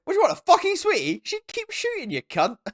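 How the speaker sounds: very high, hammed-up soldier's jeer; mock scornful retort. Soldier answering his mate's boast, high mock scorn. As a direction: throw back a squeaky mock put-down. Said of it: "And he goes".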